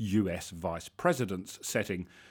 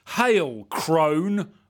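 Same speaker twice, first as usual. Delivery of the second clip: loud, higher cry of greeting; cried out; 5 semitones higher, 6 dB louder than usual. A narrator's reading. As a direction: call out boldly. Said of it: "I cried".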